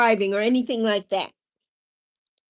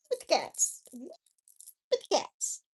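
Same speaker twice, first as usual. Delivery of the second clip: teasing echo; 3 semitones higher, 10 dB quieter than usual. Pretend. Mock echo, fading.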